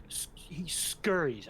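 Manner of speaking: slow, whispered "scurries"; drawn-out narration. A narrator's reading. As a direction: stretch "scurries" slowly in a whisper. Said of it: "you don't think I've ever seen a man scurry".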